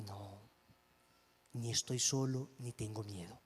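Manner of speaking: low, hushed, steady rebuke; firm quiet answer to the spirit; quiet defiance. Retold or imitated. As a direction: calmly deny being afraid. Confronting the spirit with rosario.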